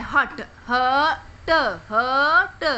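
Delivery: "हट" drawn out very slowly so the अ vowel is heard; sounding out. Shown as a demonstration. Teacher to kids, stretching the vowel sound. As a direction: stretch the अ in "हट" for the children. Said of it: "अ की आवाज़ आ रही है".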